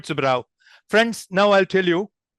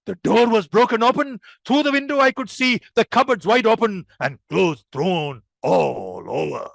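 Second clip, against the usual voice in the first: alarmed calamity report; 2 semitones higher than usual. Shown as a demonstration. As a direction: report a disaster with exaggerated emotion. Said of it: "tell you there was a calamity".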